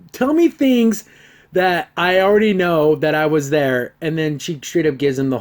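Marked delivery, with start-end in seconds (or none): 0.1-3.9 higher, louder sarcastic jab; mock impatience, imitating the train guy; sarcastic mock demand. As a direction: needle her for stating the obvious. Mocking the heroine's pointless explanation.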